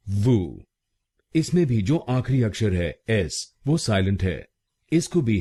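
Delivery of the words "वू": "वू" with no s at the end; syllable demo. Shown as a demonstration. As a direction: say "वू", s stays silent. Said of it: "इसको हम बोलेंगे वू". ("वू" बोलिए, एस silent)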